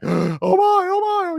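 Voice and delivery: very high, loud imitated shriek; screaming. Imitating the girl seeing the damage, screaming. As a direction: mimic her panicked scream, gasping first. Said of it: "She's, like, screaming".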